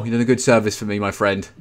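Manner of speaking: usual speaking voice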